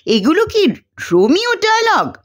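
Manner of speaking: high, astonished question; amazed question. Acted. Sharmila questions Mahendra, high and astonished. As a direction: ask in open disbelief, high. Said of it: "Sharmila বিস্ময়ে চেঁচিয়ে ওঠে".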